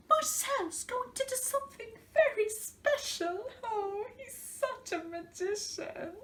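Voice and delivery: high, breathless swooning client voice; gushing salon client. Retold or imitated. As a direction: gush like a starstruck customer. Mocking fussy salon clients.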